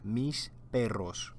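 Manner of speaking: mis perros said slowly, stress on mis; slow, careful mis perros for students; slow Spanish model. Shown as a demonstration. Teacher repeats mis perros slowly for the class. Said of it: "So repeat after me".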